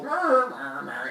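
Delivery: loud, high imitated animal bellow; cartoonish dying-bull noise; stabbed bull cry.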